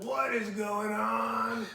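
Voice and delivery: loud, high, slowly stretched outcry; exasperated re-enactment. Retold or imitated. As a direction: drag it out in hammed-up bewilderment. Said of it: "then I got frustrated".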